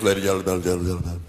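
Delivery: mock PA announcer with a stuttering echo; ballpark announcer. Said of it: "all they say is".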